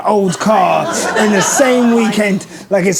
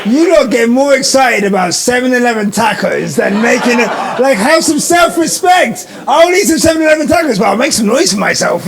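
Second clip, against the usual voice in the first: shouting to rile up the crowd; rally speech; 3 semitones higher than usual. Real, not acted. Host hyping live crowd.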